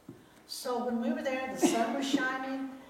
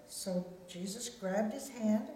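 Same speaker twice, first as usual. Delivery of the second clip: ordinary delivery, nothing marked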